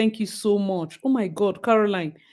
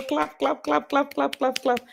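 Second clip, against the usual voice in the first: high, rhythmic chant, softer than the cheer; spoken applause; 8 semitones higher, 4 dB quieter than usual. Real, not acted. Streamer applauding Auntie Mo in words, gleeful chant. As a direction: chant it evenly like handclaps.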